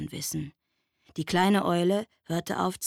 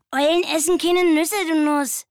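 much higher, louder know-it-all mole; 10 semitones higher, 9 dB louder than usual. A narrator's reading. Mole poking head from the earth, teasing in a high voice. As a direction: tease the squirrel as a cheeky mole. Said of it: "sagte der Maulwurf".

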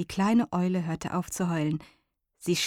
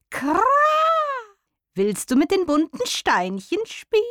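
higher, croaky-tinged raven with a caw; 13 semitones higher, 5 dB louder than usual. A narrator's reading. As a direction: caw first, then coax like a raven. Raven speaks to the owl.

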